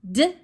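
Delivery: "D" as a lone short sound. Shown as a demonstration. Teacher starts counting sounds in dream, isolated sound. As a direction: give just the first sound, "D". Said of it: "Count out your sounds in dream".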